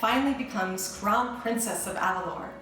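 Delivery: upbeat read-aloud intro over music; storybook intro. Acted. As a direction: introduce the book brightly to kids.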